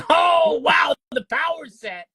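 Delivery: loud, high, excited wow; thrilled gasp at a rare find. Real, not acted. Sees the NES power set.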